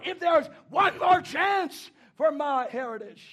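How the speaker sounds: preacher building to a high, loud plea; full-force preaching. Acted. Preacher pleading as Abraham did for his heritage, full-force preaching. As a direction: climb into shouted intercession.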